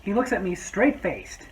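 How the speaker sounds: normal talking voice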